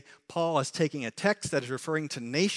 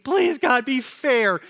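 high, loud pleading; mock plea; 9 semitones higher, 9 dB louder, slower than usual. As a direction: beg God for fairness, hammed up. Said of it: "do not come to God and plead".

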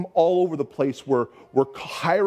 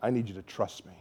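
voice of God; 6 semitones lower, 10 dB quieter than usual. Retold or imitated. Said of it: "the Holy Spirit said".

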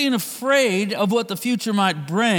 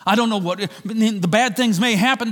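usual speaking voice

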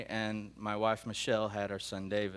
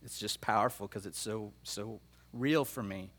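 emotional; 3 semitones lower, 4 dB quieter than usual. Real, not acted. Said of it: "I don't know why I'm emotional about it".

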